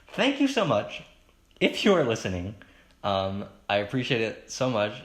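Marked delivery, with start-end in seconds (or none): 0.1-2.6 high, loud burst of thanks; emphatic redo. Real, not acted. Podcaster thanking listeners after a slip, emphatic. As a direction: gush the thanks, starting high and dropping.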